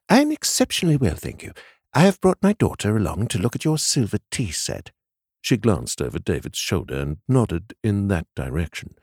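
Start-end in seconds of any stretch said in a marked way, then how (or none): 0.1-4.9 high, quick genteel society lady; elegant lady voice. Acted. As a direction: sound refined and pleased with herself. Mrs Rubenstein character speaking.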